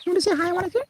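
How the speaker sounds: very high, soft coaxing voice to the cat; pet talk. Real, not acted. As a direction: gently coax the cat to meow.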